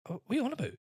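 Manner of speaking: high, put-on scoff; mock disbelief at his nerve; incredulous. Mocking the man on the flight, incredulous.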